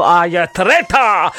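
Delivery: put-on theatrical Scottish accent with a rolled, stretched last word; imitated Scottish dramatic flourish; Alan Cummins's Scottish accent. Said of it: "they don't say it like that".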